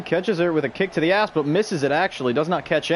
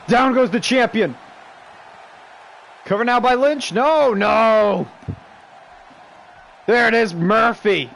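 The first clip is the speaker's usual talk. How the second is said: raised, louder commentator call; excited play-by-play; 5 semitones higher, 3 dB louder than usual. Real, not acted. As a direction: keep the energy up as the pin lands.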